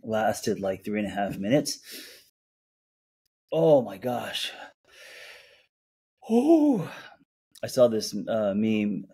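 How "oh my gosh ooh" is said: shivering gasp. Real, not acted. Cold after ice plunge.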